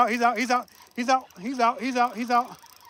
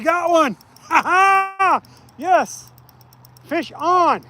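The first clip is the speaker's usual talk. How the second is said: excited shouting; 6 semitones higher, slower than usual. Real, not acted. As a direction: yell it like you just hooked one. Hooking a fish on stream.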